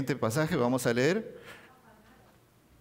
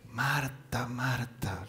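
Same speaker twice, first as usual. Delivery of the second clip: tender whisper; 3 semitones lower, 5 dB quieter, much slower than usual. Acted. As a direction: whisper the name twice, softly. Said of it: "Le dice". (Jesús llamando a Marta con ternura, susurrando)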